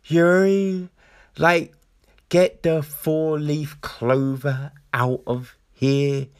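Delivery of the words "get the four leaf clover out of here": slow, deliberate mock gruff accent; drawn-out imitation of a clunky line; Judi Dench. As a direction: spell out the line word by word, deadpan. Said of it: "another bit where she's like".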